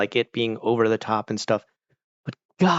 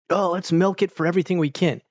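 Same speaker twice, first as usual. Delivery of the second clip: higher, eager mock-executive line; mock eagerness; 7 semitones higher than usual. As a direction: act out gleeful opportunism, pitched up. Mocking greedy American show makers.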